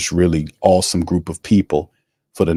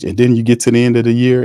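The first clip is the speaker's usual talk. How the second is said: usual speaking voice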